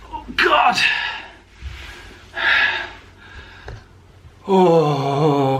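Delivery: pained high groan after exertion; sore muscles. Real, not acted. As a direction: groan out the ache in your arms. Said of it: "That was sore".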